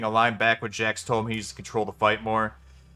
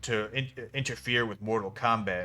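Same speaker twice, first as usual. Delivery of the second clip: lower, much softer mock Raiden; 4 semitones lower, 9 dB quieter than usual. Reviewer mocking Raiden's excuse, low and soft. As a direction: mumble it like a solemn god making excuses. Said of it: "and he's like".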